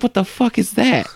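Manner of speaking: high startled outburst; shocked. Retold or imitated. Dreamer retelling seeing kid in bathroom, high shocked. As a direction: recoil in disbelief. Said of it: "I was like".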